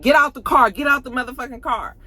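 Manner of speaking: high, loud order; a friend orders his friend out of the car; commanding shout. Retold or imitated. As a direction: bark it out urgently. Murda ordering Teak out.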